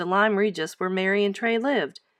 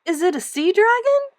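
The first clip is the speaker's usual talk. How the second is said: excited; 12 semitones higher, 5 dB louder than usual. Acted. Girl thrilled by find.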